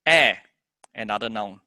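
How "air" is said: "Air" said loud and firm; stressed AIR. Shown as a demonstration. Teacher showing a stressed noun, "Air" hit hard. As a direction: punch the single word "Air". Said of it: "We're gonna stress on it".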